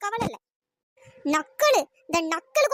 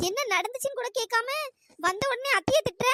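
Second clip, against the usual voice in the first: ordinary talk, nothing marked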